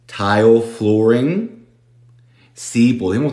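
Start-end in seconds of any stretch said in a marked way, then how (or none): 0.1-1.7 same slow rising take of flooring; slow echo. Shown as a demonstration. Teacher repeating tile flooring slowly for students.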